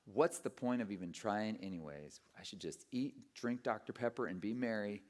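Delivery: low, soft, defeated muttering; resigned giving up. Acted. Voicing a dieter giving up.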